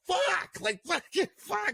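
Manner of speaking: high, frustrated cursing; frustrated swearing. Retold or imitated. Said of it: "I'm just like".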